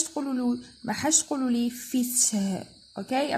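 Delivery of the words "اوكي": normal talking voice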